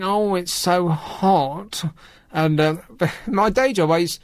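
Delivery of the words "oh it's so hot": drawn-out imitation moan; mock whining. Host mimicking everyone complaining about the heat, mock whining. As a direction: imitate complainers with a long, slow whine. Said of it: "everyone was moaning and whining".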